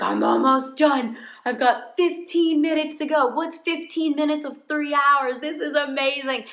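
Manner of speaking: rally yourself, excited near the finish; hyped up. Retold or imitated. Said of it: "you really start getting hyped up".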